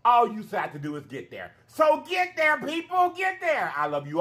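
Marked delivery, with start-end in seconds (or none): 1.7-3.7 rallying cry. Real, not acted.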